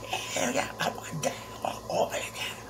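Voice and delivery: soft, breathless hushed yes; whispered excitement. Retold or imitated. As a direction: whisper it like someone might overhear. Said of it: "And I'm like".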